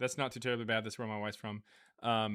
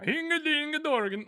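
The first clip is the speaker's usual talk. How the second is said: mock Norwegian gibberish, much higher and louder; 7 dB louder than usual. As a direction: squeal it as fake Norwegian. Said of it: "made those kinda sounds".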